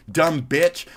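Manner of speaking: ordinary talk, nothing marked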